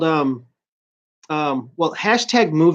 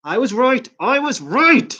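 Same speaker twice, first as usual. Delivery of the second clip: gleeful; 6 semitones higher, 3 dB louder than usual. Retold or imitated. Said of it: "'Cause I'm like".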